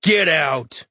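acted drunken shout, kept in check. A narrator's reading. Drunk hunter ordering aliens out of the pub, shouting. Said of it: "He shouts".

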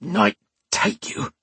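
slow, high, strained growl; hurt thug, defiant; pained growl. A narrator's reading. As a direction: force the words out through pain. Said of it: "the man growled, the pain clear in his voice".

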